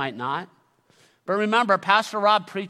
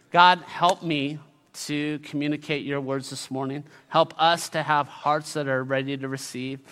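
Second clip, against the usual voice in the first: prayer; 6 semitones lower than usual. Acted. Prayer before the sermon.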